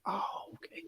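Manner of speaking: a quiet, breathless "Oh, okay"; hushed whisper. Retold or imitated. Husband answering his wife about her grandma, whispering. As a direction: whisper it softly, barely voiced. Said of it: "I was like".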